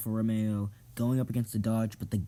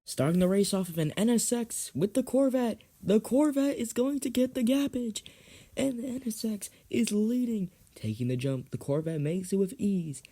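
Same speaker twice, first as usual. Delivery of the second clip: raised, sing-out race call; announcer voice; 11 semitones higher than usual. Acted. Host calling the next race, announcer voice.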